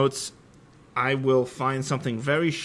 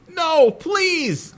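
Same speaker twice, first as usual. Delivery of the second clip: high, loud pleading cry; begging; 6 dB louder than usual. A narrator's reading. Ebony begs as Voldemort hands her a gun, high desperate plea. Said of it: "I begged".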